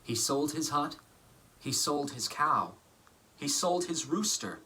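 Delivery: lively picture-book reading voice; story narration. A narrator's reading. Actor reading a picture book to children, lively storytelling. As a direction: read it warmly to children.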